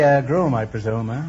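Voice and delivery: normal talking voice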